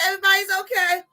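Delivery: ordinary delivery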